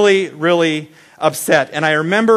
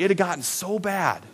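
usual speaking voice

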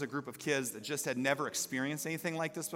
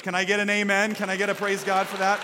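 rallying the church; 4 semitones higher, 7 dB louder than usual. Acted. Rallying congregation.

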